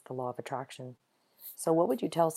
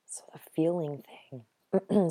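hoarse, voice fading to a whisper; voice giving out; slower than usual. Real, not acted. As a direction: voice almost gone, breathy whisper. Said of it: "losing my voice here as we go".

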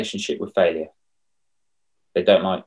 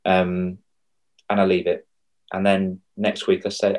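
usual speaking voice

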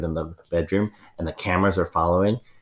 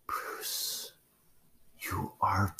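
awed whisper; 11 dB quieter, much slower than usual. Acted. Awed whisper before outburst.

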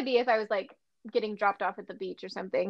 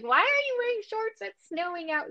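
a hint of a puzzled grandma, pitched up; grandma's scolding; 10 semitones higher than usual. Granddaughter mimics grandma questioning her shorts, mild mock scold. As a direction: lightly play a baffled grandmother. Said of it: "my grandma was like".

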